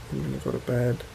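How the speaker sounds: ordinary delivery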